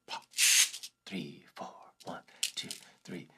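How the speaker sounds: whispered count-in. Real, not acted. Softly counting in the beat.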